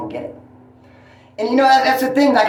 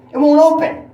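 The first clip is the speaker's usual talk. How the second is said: retold exclaim; 11 semitones higher, 6 dB louder than usual. Store clerk quoted about door.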